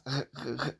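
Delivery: "r" as an isolated GH sound; soft, repeated "r" for the GH digraph; sound demo. Shown as a demonstration. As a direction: sound out "r" softly, in separate short bursts. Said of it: "That's how it is pronounced".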